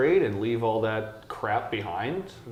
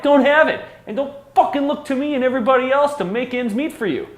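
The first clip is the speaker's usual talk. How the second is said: much higher, much louder screaming tirade; furious shout; 8 dB louder than usual. Real, not acted. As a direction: scream it at the people begging for money. Rant peaks about daycare.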